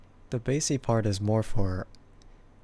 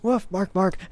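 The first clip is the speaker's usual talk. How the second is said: high, a bit louder yapping words; imitating Stux barking; 9 semitones higher, 4 dB louder than usual. Another try at Stux's bark, high. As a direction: bark it out goofy and bright. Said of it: "trying to do an impression of him".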